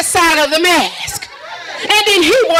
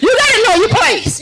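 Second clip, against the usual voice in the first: laughing through the punchline, pitched up; 7 semitones higher than usual. Acted. Comic to audience, laughing at her own church story. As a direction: giggle out know your place.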